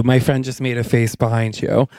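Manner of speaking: ordinary talk, nothing marked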